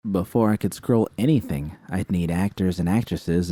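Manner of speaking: ordinary delivery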